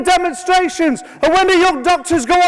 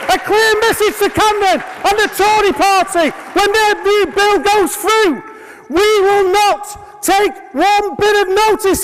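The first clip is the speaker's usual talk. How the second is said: roaring rallying speech; 4 semitones higher than usual. Real, not acted. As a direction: whip the crowd up to fight.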